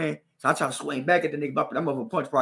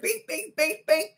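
much higher bing noises; hit noises; 14 semitones higher, slower than usual. Retold or imitated. Girl hitting his head, high bing noises. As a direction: mimic her blows.

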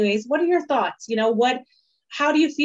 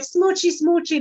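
put-on baby talk; 7 semitones higher than usual. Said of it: "And I'm like".

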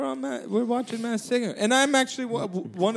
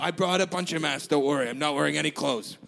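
much lower, gruff put-on man's voice; Rupert voice; 8 semitones lower than usual. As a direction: drop low and play Rupert on the phone. [name] holding Rupert voice.

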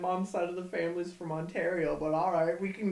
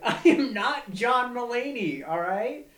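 laughing protest; 6 semitones higher, 7 dB louder, slower than usual. Real, not acted.

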